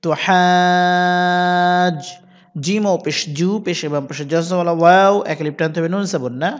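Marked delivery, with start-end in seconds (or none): 0.0-2.0 হাজ with the vowel held very long; slow drawn হাজ; four-alif stretch. Shown as a demonstration. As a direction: hold the a for four counts, then close on jim. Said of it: "চার আলিফ টেনে".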